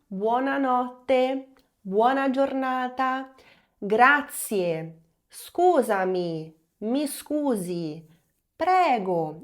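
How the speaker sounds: slow, clear Italian greetings for students; teacher modelling Italian words one by one. Shown as a demonstration. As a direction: model each word slowly to be repeated. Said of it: "vamos recapitular".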